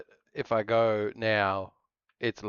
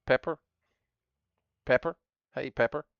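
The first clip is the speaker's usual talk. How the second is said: higher, questioning call; calling the pet; 5 semitones higher than usual. Real, not acted. Streamer calls for pet Pepper in a high questioning call.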